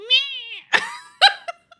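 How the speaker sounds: loud squealing laughter; high shrieking laugh. Real, not acted. Laughing hard at the cat video punchline.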